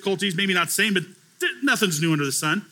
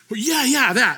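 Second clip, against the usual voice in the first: imitating an eager, higher-pitched yes; mock-excited, raised voice; eager agreement; 7 semitones higher, 4 dB louder than usual. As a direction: jump in as the man agreeing. Retold man eagerly agrees.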